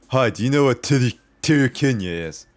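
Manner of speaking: put-on lightly slurred drunk voice; a hint of a drunk guy asking. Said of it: "kinda like in that kind of voice".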